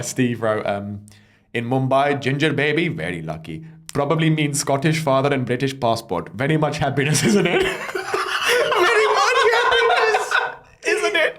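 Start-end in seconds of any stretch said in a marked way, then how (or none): 1.5-7.6 lightly put-on Indian accent, reading the email; a hint of an Indian English lilt, amused. Reading a friend's email aloud in a light Indian accent. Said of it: "read that quickly with an accent". 7.6-11.4 repeating the punchline through high laughter; cracking up on the punchline; laughing. Real, not acted. Repeating the email's punchline, cracking up laughing. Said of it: "Oh my God".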